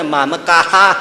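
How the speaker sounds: મામકાહ with a stiff tongue and a rising end; clumsy foreign pronunciation. Retold or imitated. Imitating the priest stumbling over મામકાહ. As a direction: struggle through મામકાહ like a foreigner. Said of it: "બોલવા જાય તો એક લવોય વળે ને".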